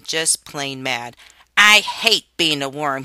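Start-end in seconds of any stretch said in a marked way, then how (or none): 1.6-3.0 put-on loud angry child's screech. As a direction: spit it out in fury. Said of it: "he screeched".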